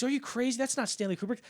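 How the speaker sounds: high-pitched, exasperated disbelief; incredulous scolding. Acted. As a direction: set him straight, as if he's lost his mind. Acted angel exclaiming in disbelief.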